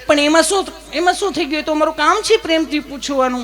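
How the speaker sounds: high, laughing sweet nurse reply; loving nurse. Acted. Nurse laughing back that asking lovingly is her job, high voice. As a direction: answer lovingly like a giggly nurse. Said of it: "તમે કેટલું પ્રેમથી પૂછો છો".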